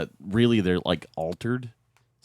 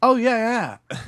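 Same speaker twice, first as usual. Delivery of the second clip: eager; 8 dB louder, slower than usual. Retold or imitated. Eager store clerk quoted.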